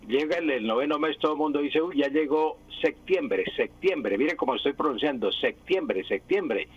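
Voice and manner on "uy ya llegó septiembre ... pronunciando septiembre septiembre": imitating septiembre said as "sektiembre"; mock the common "sektiembre", a hard K before the T. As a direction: septiembre pronounced with a K sound, no P. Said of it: "como si fuera de secta".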